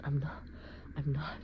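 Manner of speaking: ordinary talk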